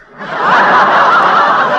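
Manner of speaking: loud, high wheezing laugh at his own joke; judge's loud breathy guffaw; hearty laugh. Acted. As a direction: cackle at your own story.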